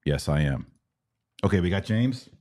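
ordinary talk

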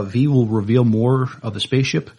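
ordinary talk, nothing marked

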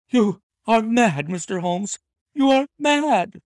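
high, loud frantic cry; cried out. A narrator's reading. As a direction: cry it out in shock and denial. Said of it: "she cried at last".

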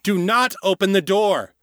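high, urgent warning; insistent high-pitched command; loud, insistent. A narrator's reading. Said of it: "the loud voice insisted".

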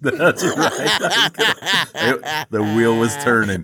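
laughing. Real, not acted. Caught joking, laughing.

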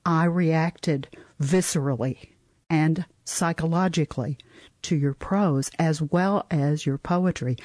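ordinary delivery

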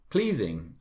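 usual speaking voice

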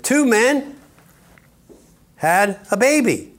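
high, loud mock headline; mock announcement. Preacher mocks the news story, high and loud. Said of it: "a couple and said".

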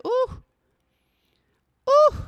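labor pain moans, high; high pained ooh. Retold or imitated. Telling the nurse her labor pain, high oohs. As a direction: let out sharp contraction ohs. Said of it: "I said".

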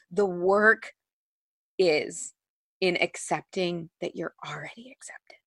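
hushed whisper, no voice; soft reverent whisper of a teacher's words. Retold or imitated. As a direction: breathe the quote in a whisper. Quoting a spiritual teacher.

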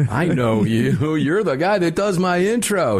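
excited recognition. Retold or imitated.

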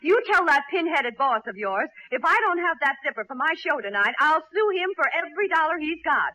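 indignant customer losing patience; angry threat. Acted. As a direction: rattle off the threat in a temper. Threatens to sue over zipper.

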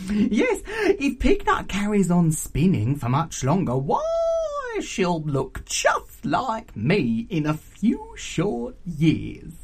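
cackling aunt, loud and drawn out; raucous auntie. Acted. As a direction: tease gleefully with laughs and stretched words.

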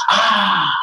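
put-on high yelp of a slipping boy; mock yell, pitched high; retold yell. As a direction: yelp as he slips. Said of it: "he yells, he goes".

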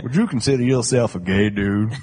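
lightly voiced talk-show host; a hint of a TV host asking; Doctor Phil impression. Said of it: "that's probably a horrible impression".

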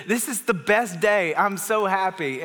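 high, slow, overjoyed imitation of a son; acted-out childlike happiness, drawn out; Bolton. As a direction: savor each word like a delighted boy. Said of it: "He said".

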